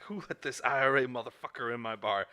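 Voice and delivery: loud acted challenge across a bar; forceful movie quote; mock mob-boss outburst. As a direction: imitate a boss calling someone out. Acts out mob boss's outburst.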